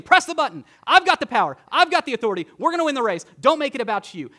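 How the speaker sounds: high, loud urgent call as the Holy Spirit; rally them to press the button; Holy Spirit shouting encouragement to the church, urgent and loud. Acted. Holy Spirit voiced urging.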